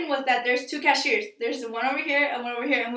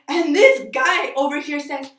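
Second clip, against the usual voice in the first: normal talking voice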